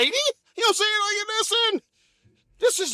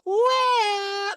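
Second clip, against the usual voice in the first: mock crying well; 6 semitones higher than usual. Wife reacts.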